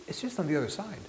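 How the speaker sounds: high-pitched villager voice; lightly spoken high guide impression; imitated villager. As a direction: toss it out high, like a local guide. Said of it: "the person would say".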